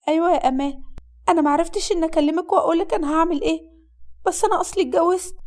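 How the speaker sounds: put-on high-pitched young woman on the phone; young bride confessing.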